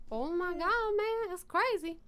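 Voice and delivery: high, slow disbelief; retold amazement. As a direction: draw it out high, as if stunned. Retold amazed reaction to surgery.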